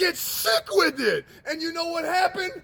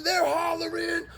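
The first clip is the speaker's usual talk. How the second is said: ordinary talk, nothing marked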